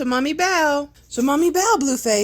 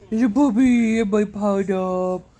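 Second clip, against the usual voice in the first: ordinary talk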